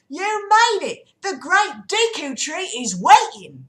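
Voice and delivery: high, loud, drawn-out Cockney greeting. Acted. Saria greets the arriving riders, loud high Cockney. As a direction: call it out slowly in a big Cockney voice. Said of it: "I'll do the Cockney".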